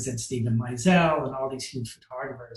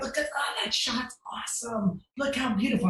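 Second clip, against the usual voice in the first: higher, airy, over-eager praise; forced gushing; 7 semitones higher, 3 dB quieter than usual. Retold or imitated. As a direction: gush politely while hiding disappointment. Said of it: "I'm trying to compliment all the photos".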